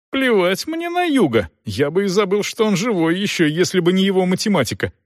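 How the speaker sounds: high, heated outburst; indignant dismissal. A narrator's reading. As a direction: brush the comparison off in a high, irritated voice. Son angrily dismisses the comparison.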